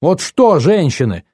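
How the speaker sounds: slow, indignant challenge, rising on the repeat; nervy aunt demanding an answer. A narrator's reading. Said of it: "нервная тётя Джесс".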